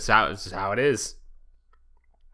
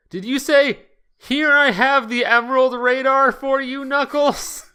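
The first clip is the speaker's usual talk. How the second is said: mock scream; 12 semitones higher than usual. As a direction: shriek it across the room. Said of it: "Knuckles screamed at the top of his lungs".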